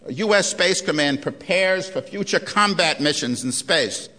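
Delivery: normal talking voice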